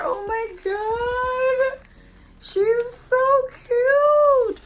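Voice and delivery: high, drawn-out coo of delight; gushing squeal. Real, not acted. Vlogger showing off her doll, squealing adoringly. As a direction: stretch every word in a high, adoring whine.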